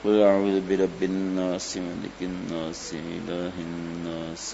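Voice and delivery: demonstrating a protective recitation; Quran recitation. Shown as a demonstration. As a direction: recite the surah softly, slowing down. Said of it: "تینوں کل پڑھ لیں تین تین بار".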